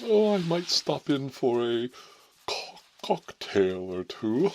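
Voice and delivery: hammed-up pompous invite. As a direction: savour each word like a snob. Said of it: "He's all pompous".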